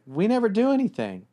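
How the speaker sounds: mock whiny girlfriend. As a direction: imitate her pouty complaint. Girlfriend complaining, quoted.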